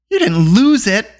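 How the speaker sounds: slow, high-pitched horrified disbelief; gasped. A narrator's reading. Eilonwy realising the cauldron may be lost, gasping. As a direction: ask aghast, drawing the words out high. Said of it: "gasped, clapping a hand".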